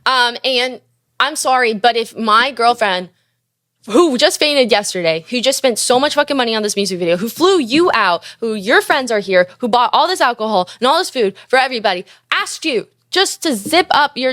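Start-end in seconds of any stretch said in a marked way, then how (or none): none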